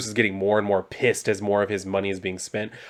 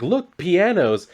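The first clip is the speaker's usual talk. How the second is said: higher, drawn-out excited call; excited shopper; 8 semitones higher, 3 dB louder, much slower than usual. Retold or imitated. Harley and Ivy shopping at the mall, excited. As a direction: play a mildly excited shopper spotting something.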